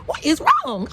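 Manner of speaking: high, loud, panicked question; bewildered high-pitched outburst; alarmed cry. Retold or imitated. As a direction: ask it in sudden alarm at yourself. Inner panic over her emotions.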